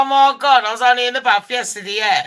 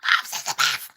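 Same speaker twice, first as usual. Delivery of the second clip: tiny squeaky voice; squeaky child voice; 10 semitones lower, 7 dB quieter than usual. Retold or imitated. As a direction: squeak it like a small boy. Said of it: "with a squeaky voice".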